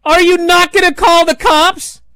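high, loud, panicked yelling; loud, high mock scream at a deadpan woman; retold scream. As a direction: shout it after a stolen car. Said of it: "And Ethan screams".

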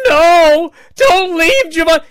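high, loud mocking crying plea; mock a whining fan begging; mocking the marks who cry don't leave; whiny crowd mark. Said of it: "marks in the crowd going".

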